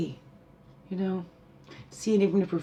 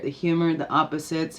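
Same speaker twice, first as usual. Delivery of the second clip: normal talking voice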